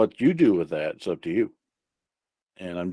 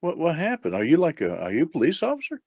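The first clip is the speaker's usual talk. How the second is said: high, bewildered questioning; feigned confusion; 7 semitones higher than usual. Acted. Blind man asks if he is police, bewildered. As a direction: act clueless and alarmed, pitch jumping up.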